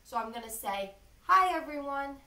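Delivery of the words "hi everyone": loud, bright greeting to the class; nice loud voice. Shown as a demonstration. Teacher greets students loudly to feel throat vibrate, loud. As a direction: call out hi to everyone. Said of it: "in a nice, loud voice".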